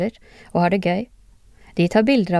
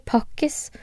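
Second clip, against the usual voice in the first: ordinary delivery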